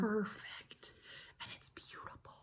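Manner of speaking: breathy whispered admiration; whispering. Real, not acted. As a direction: hush it like a precious secret. Hushed admiration of the quilt.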